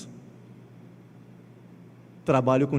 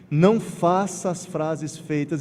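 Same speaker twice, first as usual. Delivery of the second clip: usual speaking voice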